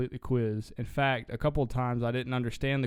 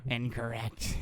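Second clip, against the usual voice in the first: evil clown voice; 5 dB quieter, slower than usual. Retold or imitated. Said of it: "this evil clown voice".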